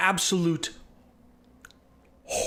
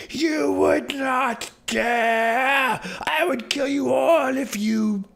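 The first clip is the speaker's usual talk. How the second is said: high, very slow snarling threat; outraged guardian; furious threat; 7 semitones higher, much slower than usual. Acted. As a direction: threaten them all, dragging every word. Enraged NPC threatens party.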